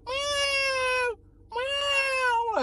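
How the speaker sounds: high mewing cat imitation; cat meows. Cats greeting him in the morning, high mock meowing. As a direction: meow like a needy little cat. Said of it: "The cats come around and told me".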